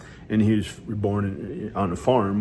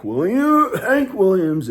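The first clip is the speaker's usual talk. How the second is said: higher, louder, eager; light up at the name; excited; 10 semitones higher, 7 dB louder, slower than usual. Real, not acted. Excited about a singer.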